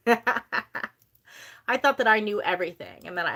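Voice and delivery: I thought that I knew everything said slowly through a laugh. Real, not acted. Repeating her old know-it-all self to viewers, laughing slowly. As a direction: laugh at yourself as you repeat it.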